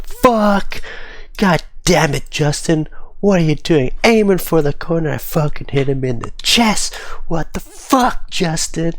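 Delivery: high-pitched furious swearing at a teammate, imitated; mock angry rant. Imitating a teammate cursing at Justin after a bad pass, high and furious. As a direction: rant like a teammate who just hit someone in the chest. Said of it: "He was like".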